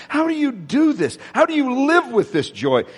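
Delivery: mild mock amazement; amazed asking. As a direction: lightly mimic people marvelling at joy. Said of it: "people who would say to me".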